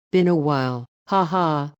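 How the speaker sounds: plain reading voice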